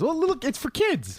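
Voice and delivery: drawn-out goofy character voice; put-on voice. Mock voice, far timbre.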